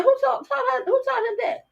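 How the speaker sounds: shocked disbelief. Retold or imitated. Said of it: "I'm like".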